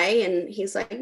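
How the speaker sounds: ordinary talk, nothing marked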